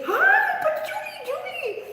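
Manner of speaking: excited greeting. Real, not acted. Excitedly greeting someone off camera.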